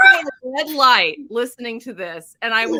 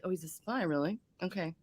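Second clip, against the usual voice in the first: deadpan mutter; 5 semitones lower, 14 dB quieter than usual. Retold or imitated. Deadpan reaction to reveal.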